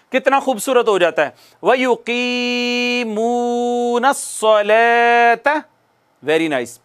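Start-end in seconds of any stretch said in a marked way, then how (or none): 1.6-5.6 वयुकीमूनस्सलात with all three long vowels stretched equally, very slow; even drawn vowels. Shown as a demonstration. Teacher reciting so students hear equal lengthening, slow chant. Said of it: "तीनों को same खींचेंगे".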